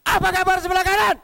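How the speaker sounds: loud greeting. Shown as a demonstration. Speaker shouting at the right side to make them stand. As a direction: bellow it to wake the room. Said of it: "saya coba yang, yang keras nih".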